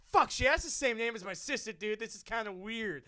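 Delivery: loud, high startled inner voice; freaked out. Retold or imitated. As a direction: blurt out your shock. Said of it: "I'm like".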